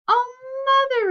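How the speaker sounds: high, loud wail of dismay; anguished cry. A narrator's reading. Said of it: "repeated Beatrix".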